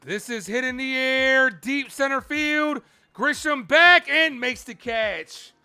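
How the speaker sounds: loud, high, drawn-out fly ball call; excited play-by-play. Real, not acted. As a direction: carry the suspense up to the catch. Live play-by-play of deep fly.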